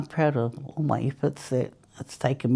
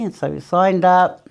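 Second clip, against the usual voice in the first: ordinary talk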